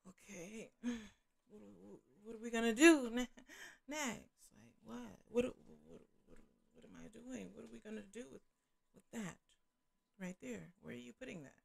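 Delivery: very soft, hesitant retold reaction; bewildered muttering. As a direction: stammer it quietly as if thinking aloud. Retold bewildered reaction to undressed man.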